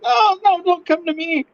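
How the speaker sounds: high, mock-panicked little kid. Kid in the outfield as the ball flies at him, high mock panic. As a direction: squeak it out like a kid dreading the ball.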